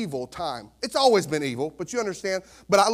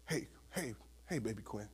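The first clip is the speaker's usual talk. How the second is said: very soft, hushed greeting; barely-there gentle voice; cooing to baby; 8 semitones lower, 18 dB quieter than usual. Retold or imitated. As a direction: murmur it tenderly to an infant. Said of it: "I hold her".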